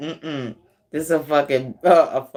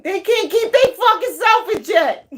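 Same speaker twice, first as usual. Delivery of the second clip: high, incredulous mocking cry; pitched-up gleeful jeer; mocking outburst; 4 dB louder than usual. Real, not acted. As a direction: crow it high and loud over their failure. Mocking someone's failed control.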